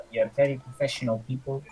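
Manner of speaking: put-on light French accent, in character; a hint of a French accent. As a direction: speak as a French local. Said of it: "Why have you suddenly put on a French accent?".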